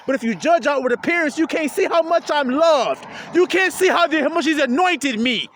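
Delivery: loud, high, fervent sermon voice; preaching at full force. Acted. Preacher exhorting his little flock, loud and fervent. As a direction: preach it out, rising and pressing hard.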